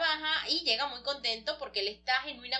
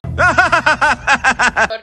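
loud laugh; 18 dB louder than usual. Pretend. Laugh after doctor's line.

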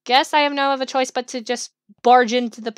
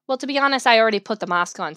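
ordinary delivery

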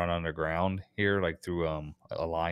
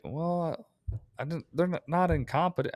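a light mock of a careful, awkward reply; hesitant hedge; 9 semitones higher than usual. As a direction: pitch it up and tiptoe around the insult. What he held back saying.

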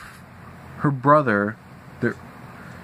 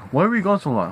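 higher mock-nagging parent; 7 semitones higher than usual. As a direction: whine it like a scolding parent. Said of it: "And I hear".

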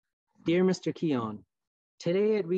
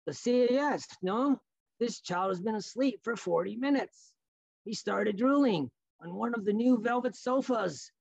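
put-on high, fussy French-accented complaint; indignant French Canadian clerk; 8 semitones higher than usual. Store man demands child welfare, high French-accented indignation. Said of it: "the man with the French voice".

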